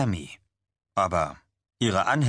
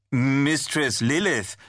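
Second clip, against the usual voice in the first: slow, higher, imperious correction; stern Mistress Lilith; 7 semitones higher, much slower than usual. Acted. Tammy corrects her follower Chad, slow and imperious. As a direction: drag out her title like a queen.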